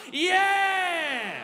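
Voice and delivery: loud, high whoop; big rowdy yell; triumphant cheer. Real, not acted. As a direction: crow with delight. Cheering the punchline.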